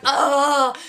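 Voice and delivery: high drawn-out "oh!"; mock cry of struggle; struggling cry. Tied-up friend trying to get away, high struggling cry.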